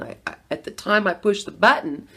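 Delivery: normal talking voice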